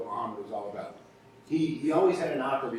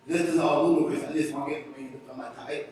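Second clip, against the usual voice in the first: usual speaking voice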